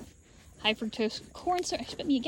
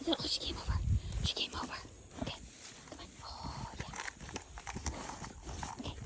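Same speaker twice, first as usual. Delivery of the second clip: hushed delighted whisper; excited whisper; 6 semitones higher, 12 dB quieter, much faster than usual. Real, not acted. The lamb comes close, excited whisper. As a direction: whisper so as not to scare her.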